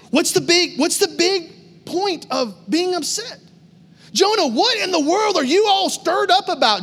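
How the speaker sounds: high-pitched, incredulous mock rebuke; exasperated scolding. God voiced scolding angry Jonah.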